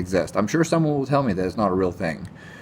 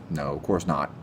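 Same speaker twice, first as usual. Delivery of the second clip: dry sarcastic no; 6 semitones lower, 5 dB quieter than usual. Real, not acted. Answering his own question, dry sarcastic deadpan. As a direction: lower and softer, deadpan.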